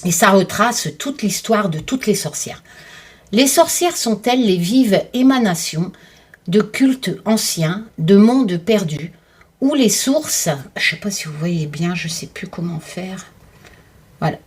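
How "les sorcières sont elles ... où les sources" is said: slow, poetic book reading; reading aloud. Acted. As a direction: read it like a mysterious blurb. Reads book blurb aloud.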